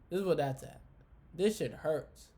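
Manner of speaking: usual speaking voice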